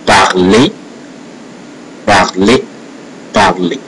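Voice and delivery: "parler" said as "par-lay"; French E like "may". Shown as a demonstration. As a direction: repeat "parler" ending on "ay", R silent. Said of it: "sound the same at the end of a word".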